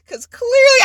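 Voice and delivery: ordinary talk, nothing marked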